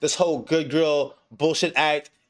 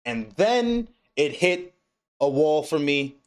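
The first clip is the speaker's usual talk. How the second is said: normal talking voice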